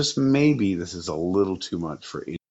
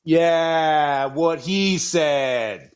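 mock sidekick; 7 semitones higher, much slower than usual. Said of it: "And then Henry's like".

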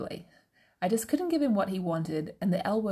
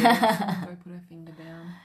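a hint of a bossy teacher; slow, louder teacher order; 4 dB louder, slower than usual. Teacher voiced in story.

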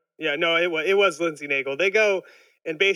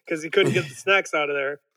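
normal talking voice

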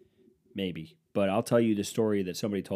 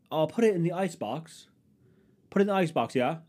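mock English; 7 semitones higher than usual. Mocking English people's speech.

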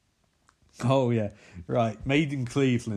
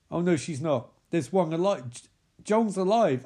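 normal talking voice

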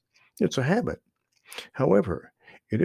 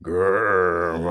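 low rumbling growl; deep animal growl; bear growl; 5 semitones lower, 5 dB louder than usual. Acted. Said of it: "growled Buster".

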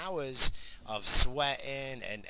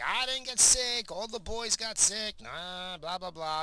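high, slow singsong tease, mocking the sick boys; teasing wife; 8 semitones higher, slower than usual. Wife gloating to the sick boys, in a high teasing singsong. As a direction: gloat playfully, stretching the words.

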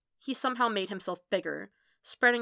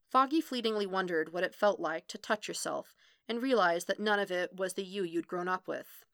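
ordinary delivery, nothing marked

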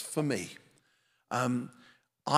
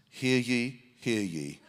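booming voice of God; 3 semitones lower than usual. Acted. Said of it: "the way the Lord spoke was like this".

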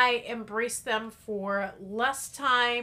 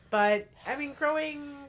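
ordinary talk, nothing marked

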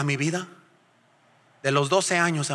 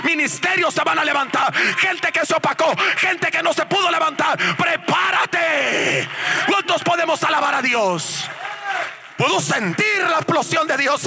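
high, shouted revival preaching; full-force preaching; 11 semitones higher, slower than usual. Real, not acted. Preacher rouses congregation to praise God, full-force preaching. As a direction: drive each promise home, stretching the key words.

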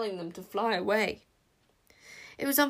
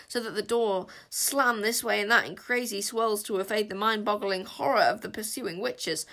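ordinary delivery, nothing marked

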